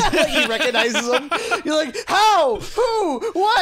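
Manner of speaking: high, loud, laughing; excited laughing yell. Real, not acted. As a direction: shout it, overjoyed. Excited fan-theory retelling.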